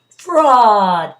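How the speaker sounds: loud echoing shout. A narrator's reading. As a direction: shout it back like an echo. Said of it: "and echo answered".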